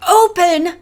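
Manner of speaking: high, loud outraged echo; thundered. A narrator's reading. Belle thundering at the gang, furious. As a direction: throw the word back in fury. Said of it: "thundered Belle".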